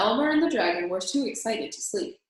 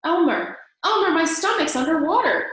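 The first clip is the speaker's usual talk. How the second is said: higher and louder alarmed call; dragon crying out; 9 semitones higher, 7 dB louder than usual. A narrator's reading. Dragon waking Elmer as water rises, crying out. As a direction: wake him up in alarm. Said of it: "cried the dragon".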